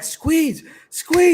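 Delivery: high-pitched re-enacted urging; excited cheering. Fan in his car watching the fight, excited urging. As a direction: urge the fighter on, pitch leaping up.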